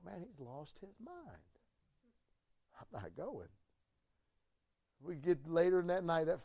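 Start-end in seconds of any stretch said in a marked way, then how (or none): 0.0-3.5 much softer, slow muttered aside; hushed inner thought. Retold or imitated. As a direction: think it to yourself quietly.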